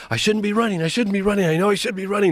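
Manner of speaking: retelling his own frantic running mantra; panicked self-talk. Retold or imitated. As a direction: scold yourself in a rush of panic. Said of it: "So I'm running going".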